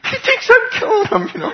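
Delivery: helpless laughing squeal. Real, not acted. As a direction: crack up as you say it. Laughing at his own story.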